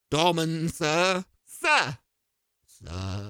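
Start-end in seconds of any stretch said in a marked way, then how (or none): 2.7-3.3 deep doorman. Acted.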